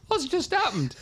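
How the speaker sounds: very high, loud mock bewilderment; hammed-up shocked squeal; stunned disbelief. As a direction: blurt it out like a flabbergasted rookie. Stunned young player's retold reaction.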